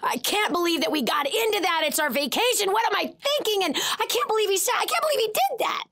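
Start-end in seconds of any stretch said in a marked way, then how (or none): 2.7-5.9 indignant inner rant. Retold or imitated. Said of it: "I was stewing over".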